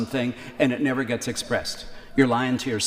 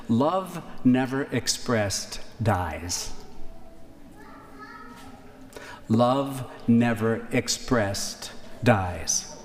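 slow deliberate quote; much slower than usual. Real, not acted. Quoted maxim, repeated slowly.

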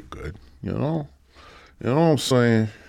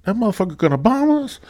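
higher, fast panicked prince; mock alarmed prince; 7 dB louder than usual. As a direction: squeal it like a startled royal. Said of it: "Prince gonna be like".

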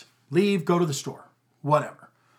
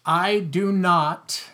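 slow and louder, every word weighted; emphatic; 4 semitones higher, 6 dB louder, much slower than usual. Real, not acted. Father stating his parenting rule to the podcast host, slow and emphatic. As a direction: plant each word firmly, no wiggle room. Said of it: "I'm gonna say this emphatically".

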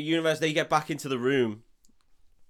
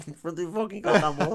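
high, exasperated mock disbelief; hammed-up incredulous retort; mock incredulity; 7 semitones higher, 4 dB louder, faster than usual. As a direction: act out baffled protest, pitched up with a laugh. Acted incredulous reply in retold film.

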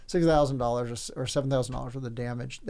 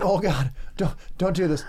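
stifling a laugh; 3 semitones higher than usual. Retold or imitated. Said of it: "And I'm like".

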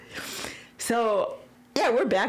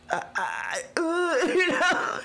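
choked up; 9 semitones higher, slower than usual. Real, not acted. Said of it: "tearing up as I'm saying this".

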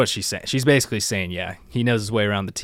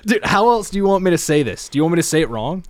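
1 exasperated; 7 semitones higher, 3 dB louder, faster than usual. Real, not acted. Exasperated at friend's nitpicking.

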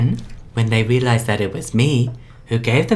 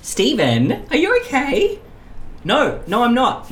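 high-pitched, pleading and fed up; high upset voice; 12 semitones higher, slower than usual. Acted. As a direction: voice him much higher, on edge. Upset bus passenger character.

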